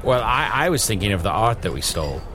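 normal talking voice

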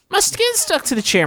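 high pitched pitiful whine; high distressed wail. Acted. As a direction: wail it like you're stuck. Reading stuck poster, high.

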